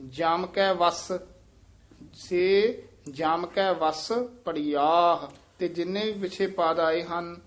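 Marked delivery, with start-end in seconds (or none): 2.1-5.3 ਪੜੀਆਹ ends on a soft breathy aah; slow model line, ਪੜੀਆਹ ending lightly on aah; teacher models ਪੜੀਆਹ with a soft final aah. Shown as a demonstration. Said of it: "ਪੋਲਾ ਹੀ ਬੋਲਣਾ ਹੈ।".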